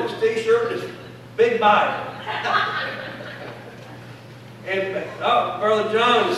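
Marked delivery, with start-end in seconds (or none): 2.2-4.1 breathy laugh. Real, not acted. Laughs at Brother John's outfit.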